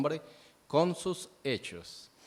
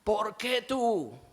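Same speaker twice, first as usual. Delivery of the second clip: high, loud and slow, a heated accusing yell; angry shouting; 9 semitones higher, 4 dB louder, much slower than usual. Retold or imitated. A believer in a quarrel, accusing the other, in a slow angry yell. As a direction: hurl the question at the other person like a fight. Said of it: "viene la ira ... la gritería".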